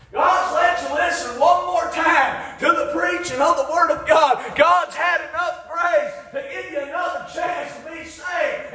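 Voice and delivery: full-force preaching. Acted. Preacher exhorting the congregation, full-force preaching.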